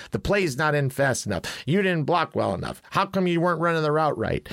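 imitating a coach who nitpicks every play; coach chirping. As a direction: lightly voice a picky coach griping. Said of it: "he literally chirps at everyone".

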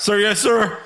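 high, loud nervous private; recruit voice. Acted. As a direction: answer like a scared recruit. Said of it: "Do you wanna be Private Cowboy".

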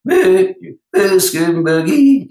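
high, punchy country hey hits. Retold or imitated. Coach imitating a country star's vocal hook, high and punchy. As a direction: throw in those country-star hey hooks. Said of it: "like [name] used".

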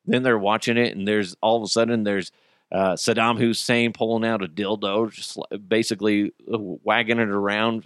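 normal talking voice

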